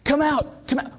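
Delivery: high-pitched mock yell at the grave; retold graveside shout. Youth leader demonstrating shouting at a grave for junior highers, mock shout. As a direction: call out as if rousing the buried. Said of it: "stand and shout until the person".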